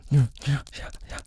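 ordinary delivery

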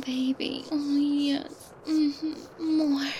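ordinary talk, nothing marked

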